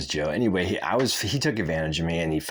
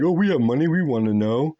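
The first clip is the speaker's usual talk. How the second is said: much higher and louder mock rich voice; mocking rich people; 10 semitones higher, 7 dB louder than usual. Mocking rich people on the wait list, high whiny voice. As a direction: whine it like a spoiled rich wannabe. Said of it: "They're just like".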